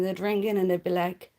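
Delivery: normal talking voice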